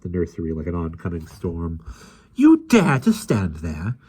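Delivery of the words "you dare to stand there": slow, loud, stern nanny; slow stern accusing voice; stern accusation. A narrator's reading. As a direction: spell each word out as a threat. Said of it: "she accused him sternly".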